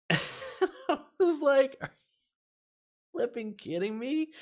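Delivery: high, slow laugh-filled disbelief. Real, not acted. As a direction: laugh through the outrage. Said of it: "I was so brutally humiliating".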